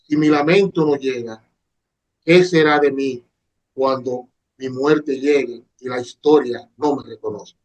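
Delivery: slow, solemn reading with long pauses; dramatic poem recitation. Shown as a demonstration. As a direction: recite it like a grieving poet. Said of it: "tratar de ver si me invento un poema".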